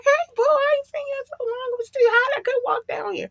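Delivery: very high cooing voice; high baby talk to a car. Retold or imitated. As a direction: coo to your beloved car like a sweetheart.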